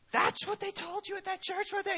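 high, incredulous disbelief; a mother who can't believe her ears; shocked mom. Acted. Mom questioning her son about Sunday school, high and incredulous.

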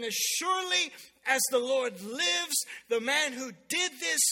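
loud, slow, weighty royal wrath; acted anger, loud and deliberate; burning anger. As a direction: pronounce a furious oath, drawing out each phrase. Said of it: "David burns with anger".

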